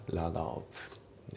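"ラダオ ブ" run together with a voiced tap for the T; linked pronunciation. Shown as a demonstration. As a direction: link "ラダオ ブ" as one word, T softened to D. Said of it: "ロットオブのところがリンキングで".

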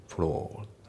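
"フロー" as a long o without any r; British-style floor, r dropped; silent R. Shown as a demonstration. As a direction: end フロー on the open vowel. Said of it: "このRがあまり".

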